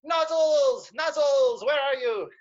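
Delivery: high, loud call from the hilltop. Acted. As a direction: shout for a lost friend. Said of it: "to call out for Nozzles".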